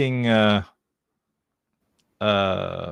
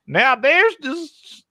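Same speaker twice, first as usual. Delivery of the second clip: put-on Southern US accent; 8 semitones higher than usual. Host taking the dare to explain crypto, hammed-up Southern accent. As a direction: play a high-pitched Southern US yokel. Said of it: "now do it in a Southern U S accent".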